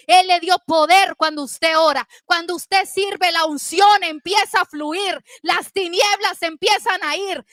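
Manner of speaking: impassioned pulpit exhortation; fired-up preaching. Real, not acted. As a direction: urge the sisters onward with conviction.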